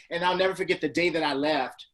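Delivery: usual speaking voice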